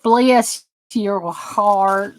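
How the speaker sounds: drawn out slowly, "Bless your heart" in a Southern drawl. Shown as a demonstration. Streamer shows her Southern catchphrase, slow Southern drawl. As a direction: savor each word, Southern style. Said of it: "I've got a hashtag bless your heart".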